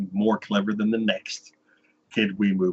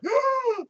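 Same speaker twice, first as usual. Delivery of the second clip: high gasping intake of breath; sharp, high mock gasp; mock crowd gasp. Crowd gasps at incantation.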